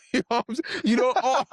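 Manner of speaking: high-pitched laughing through words. Real, not acted. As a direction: crack up mid-sentence. Laughing at own story.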